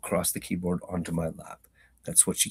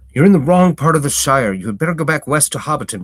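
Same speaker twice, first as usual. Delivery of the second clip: farmer's line, louder and faster; louder retake; 9 semitones higher, 13 dB louder than usual. Acted. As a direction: hit it harder the second time. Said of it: "reread after break, much louder".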